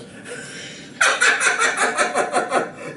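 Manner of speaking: high, wheezing cop laugh; hammed-up guffaw; mimicked belly laugh. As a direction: laugh like a cop doubled over.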